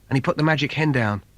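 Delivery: ordinary delivery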